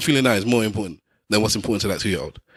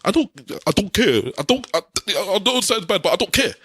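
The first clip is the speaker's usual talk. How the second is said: high sulky mimicry of him; mocking whiny excuse; 8 semitones higher than usual. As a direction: mimic his excuse, higher and petulant.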